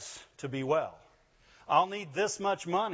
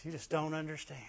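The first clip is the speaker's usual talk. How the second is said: mock pitiful; 8 dB quieter than usual. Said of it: "I just hate that sentence".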